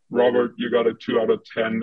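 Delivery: ordinary delivery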